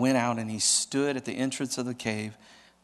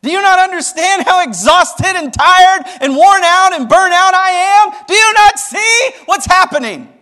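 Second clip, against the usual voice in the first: much higher and louder, a worn-out outcry; exasperated pleading; 17 dB louder than usual. Acted. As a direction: plead with God at the end of your rope. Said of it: "he's like".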